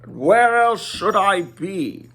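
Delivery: a dry rhetorical question; wizard's voice. Acted. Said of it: "said the wizard".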